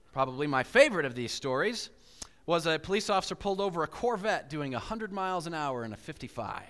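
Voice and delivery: normal talking voice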